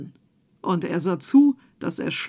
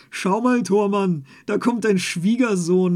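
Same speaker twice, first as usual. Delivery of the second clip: mocking tailor; 6 dB louder than usual. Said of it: "lachte der Schneider".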